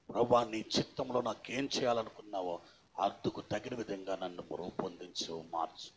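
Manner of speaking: hushed, low, submissive prayer; model prayer. Shown as a demonstration. Preacher shows believers what to ask God, praying low and softly. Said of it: "దేవుని దగ్గర అడగాల్సింది".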